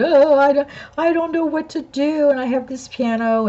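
high, strained voice, close to sobbing; tearful, choked. Retold or imitated. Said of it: "I can barely talk because I'm still crying".

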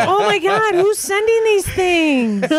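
high, slow put-on Mexican accent, imitating Mary; playful mock Mexican accent, drawn out. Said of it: "Why are you saying it with a Mexican accent?".

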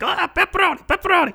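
put-on high, squawking nerdy child; nerdy little kid. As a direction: imitate an overexcited kid with glasses. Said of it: "nerdy kid with glasses just being like".

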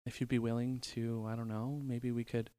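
shy mock date request. Mock asking her out.

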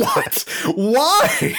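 high-pitched cry of mock outrage; exasperated disbelief. Player reacting to an unexplained mission failure, exasperated disbelief. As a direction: wail it in hammed-up bafflement.

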